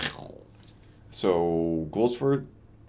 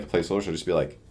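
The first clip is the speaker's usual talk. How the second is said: ordinary delivery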